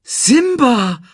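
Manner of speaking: high, loud astonished name; astonished recognition. A narrator's reading. Lioness recognises Simba, astonished and high. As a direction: cry the name in amazement. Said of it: "fragte die Löwin".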